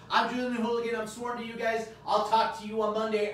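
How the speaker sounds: high, loud upbeat signoff; energetic signoff. Real, not acted. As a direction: sign off with big energy.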